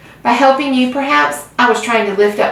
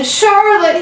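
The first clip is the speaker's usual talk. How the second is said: high, moaning wail of grief; a sobbing high moan; 12 semitones higher, 4 dB louder than usual. A narrator's reading. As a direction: moan her name in agony. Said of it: "he moaned".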